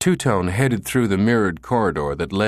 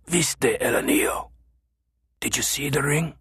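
muffled voice; 7 semitones higher than usual. A narrator's reading. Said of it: "the muffled voice".

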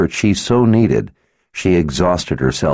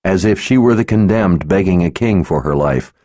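usual speaking voice

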